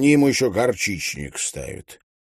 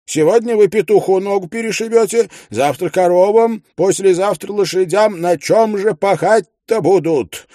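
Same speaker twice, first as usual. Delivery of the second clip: indignant widow; 7 semitones higher than usual. A narrator's reading. Widow scolds narrator over her rooster, high indignant voice. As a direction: bristle at him like an offended landlady. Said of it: "говорит".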